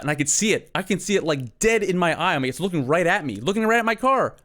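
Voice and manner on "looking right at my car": ordinary delivery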